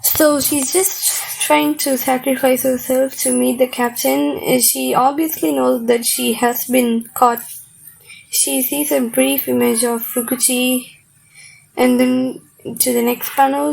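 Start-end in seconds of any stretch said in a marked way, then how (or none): none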